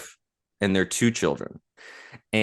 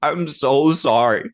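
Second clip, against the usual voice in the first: high, slow child's apology, imitated; little boy voice, pitched up and slowed; sorry kid voice; 7 semitones higher, 3 dB louder, slower than usual. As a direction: drag out a tiny boy's sorry. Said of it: "And he goes".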